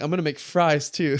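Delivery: retelling a sudden rush of excitement; excited. Retold or imitated. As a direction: blurt it out, getting ahead of yourself. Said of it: "And then I got excited".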